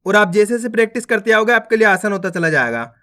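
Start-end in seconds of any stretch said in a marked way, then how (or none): none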